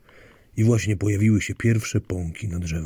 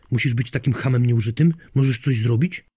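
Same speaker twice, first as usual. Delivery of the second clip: louder, higher nagging questions; exasperated wife; 4 semitones higher, 6 dB louder than usual. Acted. Wife demands her husband finally do something, exasperated. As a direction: challenge him with raised, irritated voice.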